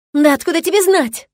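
high, loud angry burst; exploding. A narrator's reading. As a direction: blow up at him. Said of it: "ожидаемо взрывается Виктория".

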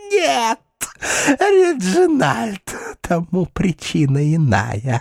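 high, slow witch voice; high taunting voice. Acted. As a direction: stretch his name out slowly. Ulrica taunts knight.

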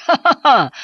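loud amused laugh; hearty laugh. A narrator's reading. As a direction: let out a big laugh. Commentator laughs at the pig.

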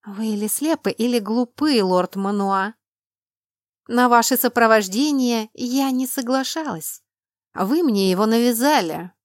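loud indignant scolding; angry. Acted. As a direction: lash out at him in anger. Heroine scolds lord, loud.

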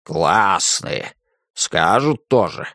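low, slow grumble of the house spirit; grumbling old spirit. A narrator's reading. Kuzma grumbling at modern slang, low and slow. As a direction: grumble it low under the breath. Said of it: "проворчал Кузьма Кузьмич".

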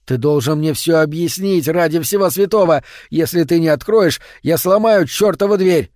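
threatening shout. Acted. Visitor threatening to break the door, shouting.

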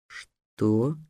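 low, soft hoarse wheeze. Acted. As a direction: rasp it out in disbelief. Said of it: "просипел Гавар". (сиплый шёпот)

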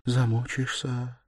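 slow, high, imploring woman; desperate plea. A narrator's reading. Sonya imploring him, in desperate entreaty. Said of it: "повторяла она в отчаянной мольбе".

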